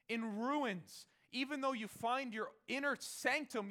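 loud, high ringing proclamation; proclaiming. Acted. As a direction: proclaim it to the ruined city.

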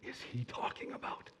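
whispered inner thought; puzzled whisper. Acted. Servant puzzling silently over Elisha's words, whispered. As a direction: wonder it to yourself under your breath.

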